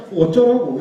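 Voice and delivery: loud, high snapped retort; exasperated retort. Real, not acted. Preacher snapping at doubters, loudly exasperated. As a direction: fling the question back, fed up.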